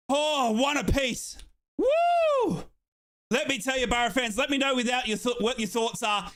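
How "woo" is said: high, loud whoop; excited whoop. Real, not acted. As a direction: whoop in celebration. Celebrating equaliser.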